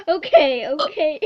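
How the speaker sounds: loud laughing okays. Real, not acted. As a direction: laugh through the words. Laughing through okay.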